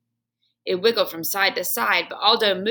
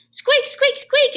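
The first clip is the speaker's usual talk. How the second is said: very high, loud squeaks; hamster squeaks; 6 dB louder, slower than usual. Acted. Hamster answering the janitor in squeaks. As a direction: squeak like a tiny excited hamster.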